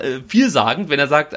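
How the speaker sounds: ordinary talk, nothing marked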